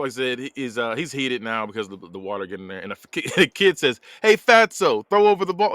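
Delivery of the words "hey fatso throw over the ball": higher, louder taunting call; mock kid's holler. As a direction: call out like a heckling kid. Retold kid calling for ball.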